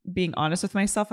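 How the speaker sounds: ordinary talk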